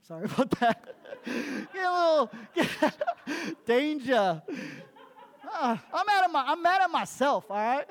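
loud, high, laughing through the words; real laughter breaking into the sermon; laughing at himself. Real, not acted. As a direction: crack up and push on through. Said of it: "You know what's funny".

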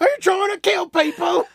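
shouted, very high chef tirade; hammed-up furious chef; Gordon Ramsay. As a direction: scream at the kitchen in mock outrage. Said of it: "watching a lot of Gordon Ramsay".

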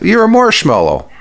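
high-pitched teasing jab; mocking taunt. Real, not acted. Host answering an unhappy commenter, mocking taunt.

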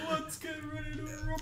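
high mock announcer call; fighting game announcer. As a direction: hype it like a ring announcer. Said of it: "Announcer voice?".

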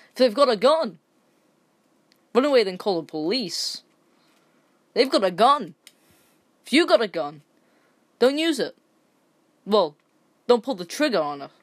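heated rant. Real, not acted.

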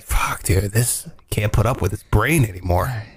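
low, groggy body grumble; a fed-up morning groan; voicing the body. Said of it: "your body's like".

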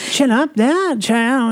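high mock-royal British voice; put-on upper-crust British accent; posh royal accent. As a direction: parody a royal, pitched well up. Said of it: "the royals, they speak in the very".

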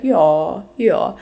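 imitating a local Alsatian saying Ja, ja; a light touch of Alsatian dialect on Ja, ja. Imitating the Alsatian dialect while describing its sound. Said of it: "you would be like".

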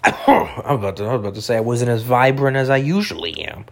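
ordinary talk, nothing marked

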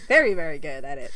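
amused echo of the praise; laughing. Real, not acted. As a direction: laugh through the words.

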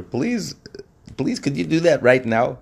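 high, pressing plea; urgent pleading. Retold or imitated. Rabbi pleads for a favor.

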